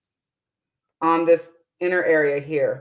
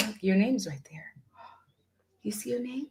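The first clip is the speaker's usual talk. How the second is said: whisper; 4 semitones higher, 10 dB quieter, faster than usual. Real, not acted. Mother whispering to her daughter about her name on screen, whispered. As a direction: breathe it to her, no voice.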